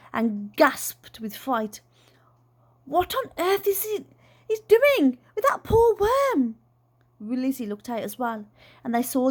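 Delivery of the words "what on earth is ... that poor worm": high, shrill-edged alarm; horrified. Acted. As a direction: recoil in horror at the sight. Aunt horrified by worm.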